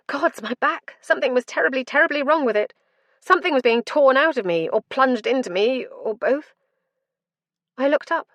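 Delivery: rising pain and panic. A narrator's reading. Narrator in agony.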